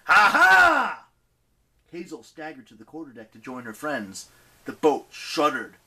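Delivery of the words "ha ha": loud, high, joyful battle laugh; gleeful laugh. Acted. Coach Hedge leaping over the rail, gleeful shouted laugh. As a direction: whoop it as he leaps. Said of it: "with a gleeful".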